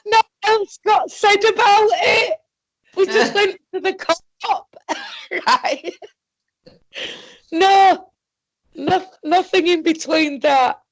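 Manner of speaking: high-pitched, helpless laughter through the words; laughing. Real, not acted. Telling a friend about the co-op trip, laughing hard. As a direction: squeak it out while cracking up. Giggling over the absurd co-op trip.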